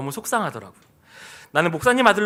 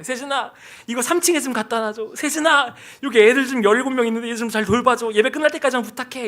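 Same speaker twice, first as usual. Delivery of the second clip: higher-pitched, breezy mock request; church members handing out chores; 5 semitones higher than usual. Imitating church members asking the pastor's son for chores, pushy. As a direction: imitate someone piling on favors. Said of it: "그러니까 그렇게 저에게 뭐 뭘 시키는 거예요".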